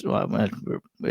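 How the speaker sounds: ordinary talk, nothing marked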